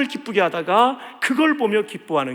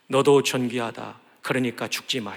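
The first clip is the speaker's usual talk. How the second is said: tender encouragement; 6 semitones lower than usual. Shown as a demonstration. Pastor modelling a tender word to a despairing neighbour. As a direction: soften it like comforting a friend. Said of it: "그래서 우리 이렇게 한번 격려합시다".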